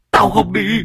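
high wailing refusal; howling refusal. Acted. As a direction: wail it out in terror. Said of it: "mà rú lên".

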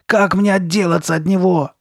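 loud, high-pitched plea; imploring. A narrator's reading. Girl begging to be rid of the face, imploring. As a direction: beg desperately for a way out. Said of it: "умоляла его Карли Бет".

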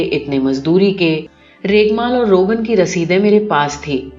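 ordinary delivery, nothing marked

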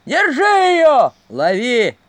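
loud, slow chase cry; shouting. Acted. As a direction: bellow at a fleeing girl. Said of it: "слышались голоса".

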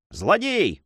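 high-pitched outburst; exclaiming. A narrator's reading. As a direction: hurl out the accusation. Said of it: "воскликнул Дон Санчо". (гневное восклицание)

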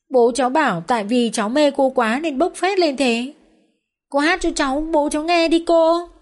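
put-on high, eager child's voice; young daughter pestering her father and Vân, high childish voice; chatty little girl. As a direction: play a pushy, adoring little girl. Child character's voice.

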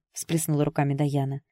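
plain reading voice

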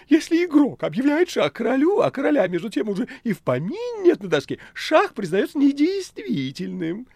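very high, loud cat voice; high-pitched, theatrical cat reasoning; cat Behemoth arguing. Acted. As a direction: squeal it like a pedantic cat. Cat argues his case.

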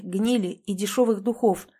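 ordinary delivery, nothing marked

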